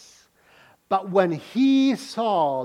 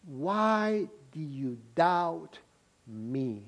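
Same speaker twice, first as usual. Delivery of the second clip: slow, soft gentle reproach; soft rebuke; 5 semitones lower, 9 dB quieter, much slower than usual. Acted. Jesus softly questioning Peter's doubt, slow and gentle. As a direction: ask it slowly and tenderly.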